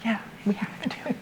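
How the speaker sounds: hushed, quiet library voice; library whisper. Real, not acted. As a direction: whisper with a little laugh. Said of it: "now we can use our regular voices again".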